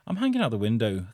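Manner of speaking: usual speaking voice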